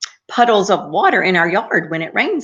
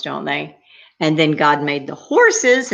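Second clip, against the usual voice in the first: usual speaking voice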